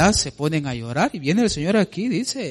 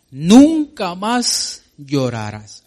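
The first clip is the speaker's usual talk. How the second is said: emphatic verse reading; 6 dB louder, much slower than usual. Acted. Preacher reads verse with force.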